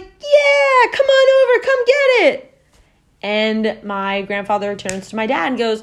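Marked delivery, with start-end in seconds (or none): 0.0-2.6 very high, loud mock holler; shouting back. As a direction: imitate boaters yelling across the water. Other boat shouts back across water.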